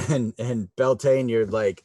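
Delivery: ordinary talk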